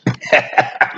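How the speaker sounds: soft, voiceless chuckle; rueful laugh. Real, not acted. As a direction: laugh softly at something that isn't funny. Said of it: "I'm not laughing 'cause it's funny".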